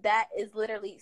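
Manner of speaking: ordinary talk, nothing marked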